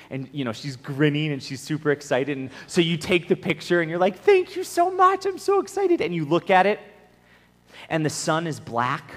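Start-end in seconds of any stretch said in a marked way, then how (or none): 4.2-6.0 overdone excitement. Acted. Grown-up receives a child's drawing, exaggerated delight. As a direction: gush like a grown-up humoring a child.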